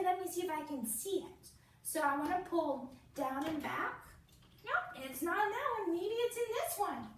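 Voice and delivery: hammed-up storytime surprise; playful excitement. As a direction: hunt for the bunny with a toddler. Bunny game with the child.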